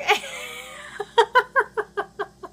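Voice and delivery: long high-pitched laugh, loud. Real, not acted. Vlogger laughing at his misspelled school project, loud high laugh.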